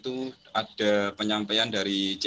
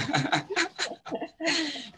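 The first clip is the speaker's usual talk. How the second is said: high embarrassed laugh. Real, not acted. Embarrassed about village signal.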